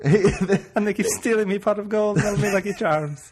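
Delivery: high Irish leprechaun voice, laughing. Retold or imitated. Continues the leprechaun bit.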